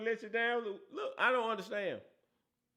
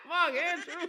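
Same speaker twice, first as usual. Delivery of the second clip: higher, shouted name through laughter; laughing call-out; 9 semitones higher than usual. Real, not acted. Host summoning Mark Andrews to the hot seat, laughing shout. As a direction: call him out while laughing.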